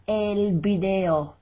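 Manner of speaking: el video said slowly, V as B, bideo; drawn-out bideo for learners; slow repeat. Shown as a demonstration. Teacher repeats el video slowly so students hear the B. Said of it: "V is pronounced like a B".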